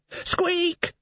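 high shrill squeak; tiny rat shouting a squeak. Acted. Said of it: "shouted the Death of Rats".

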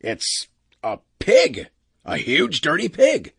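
mild disgusted outburst, drawn out at first; scornful exclamation. Acted. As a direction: scoff at the animal, rising in pitch. Captain scorns the wub.

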